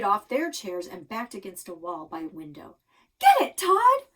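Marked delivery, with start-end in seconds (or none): 3.2-4.1 loud, high panicked yell; panicked shout. A narrator's reading. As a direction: scream at husband to grab the snake. Mom panics at snake.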